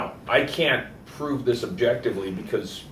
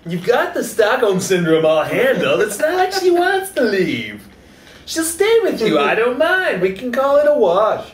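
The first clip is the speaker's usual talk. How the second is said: much higher and louder, hammed-up haggling; loud mock negotiator; 8 semitones higher, 9 dB louder than usual. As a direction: push the deal loudly and brightly. Mock hostage negotiation bit.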